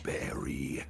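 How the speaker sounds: low, raspy, growly "Barry."; raspy growl. Shown as a demonstration. Voice actor demonstrating his villain's signature line, a low raspy growl. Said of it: "go raspy and growly".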